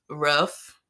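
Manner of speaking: "Roof" said like "ruff"; short vowel in "Roof", rhyming with "tough"; local pronunciation. Shown as a demonstration. Listing local words, "Roof" with a short vowel. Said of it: "things that people say here".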